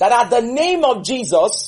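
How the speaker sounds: high, slow, projected "name of Jesus"; model Bible reading. Shown as a demonstration. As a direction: proclaim "name of Jesus" to show readers how. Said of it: "That's how you should be telling them".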